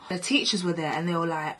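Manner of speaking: ordinary talk, nothing marked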